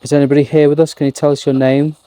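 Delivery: loud call into the dark; projecting to unseen spirits. Real, not acted. Calling out to spirits.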